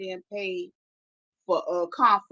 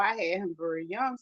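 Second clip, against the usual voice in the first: usual speaking voice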